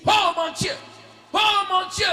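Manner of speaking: fervent demonstrated prayer cry; loud invocation. Pretend. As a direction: cry out high and loud to God.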